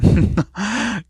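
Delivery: high mocking laugh; villain laugh. A narrator's reading. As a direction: laugh down at the hero. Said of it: "start laughing again".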